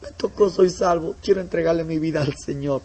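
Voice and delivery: high, half-whispered, broken with tears; tearful convert. Retold or imitated. New convert telling the pastor he is saved, tearfully. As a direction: choke it out like a moved new believer. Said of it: "Y dice".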